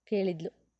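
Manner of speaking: ordinary delivery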